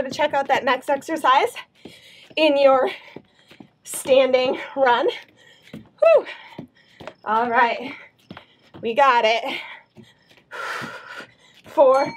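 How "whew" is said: exhausted whew. Real, not acted. Exhale after hard set.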